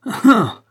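high, loud burst of "Ah"; short forced laugh, pitched high; laughing exclamation. A narrator's reading. Malone covering his flight with a laugh, exclaiming. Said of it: "s'écria Malone d'un rire sourd".

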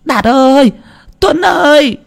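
frantic high-pitched call. A narrator's reading. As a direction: shout for the boys next door. Calling boys.

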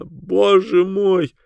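high, drawn-out anguished wail; slow, high-pitched despair; hysterical cry. A narrator's reading. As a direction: wail in horror at yourself. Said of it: "закричала она".

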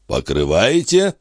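mildly indignant, drawn-out question. A narrator's reading. Balsamo challenging the police chief's accusation, mildly indignant. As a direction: throw the word back, slowly affronted. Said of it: "возмутился Бальзамо".

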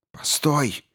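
high, sudden call to stop; sudden exclamation. A narrator's reading. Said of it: "вдруг воскликнул".

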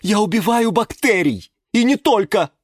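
high-pitched triumphant crowing; high, emphatic bragging; boastful shouting. A narrator's reading. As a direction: boast at a rival loudly. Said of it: "закричала герань Антонина".

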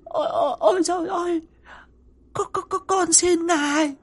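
very high, stammering terrified begging; distorted pleading. A narrator's reading. Terrified wife begging the spirit for mercy, high tearful stammer. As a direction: beg for mercy in a tearful squeal. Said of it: "méo mó van xin".

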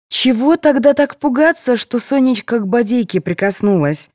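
high-voiced jab; needling. A narrator's reading. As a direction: needle her with the question. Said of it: "уколола Петрову мать Яковлевой".

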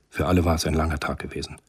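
normal talking voice

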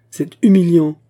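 loud, high burst of outrage; outraged outburst. A narrator's reading. The musketeer bursts out at the humiliation, loud and outraged. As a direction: explode with wounded pride. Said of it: "poursuivit le mousquetaire".

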